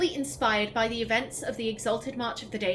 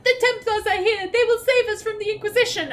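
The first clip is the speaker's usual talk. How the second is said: much higher, louder, fast hopeful plea; mock pleading woman; 10 semitones higher, 5 dB louder than usual. Streamer mocks Mother Havara hailing the Templars, high and shrill-loud. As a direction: ham up a naive hopeful cry. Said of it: "This is the woman that".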